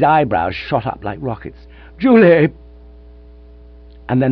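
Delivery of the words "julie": loud astonished cry of her name; amazed shout. A narrator's reading. Old man recognising his lost love, loud astonished cry. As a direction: blurt the name out in disbelief. Old friends suddenly reunited.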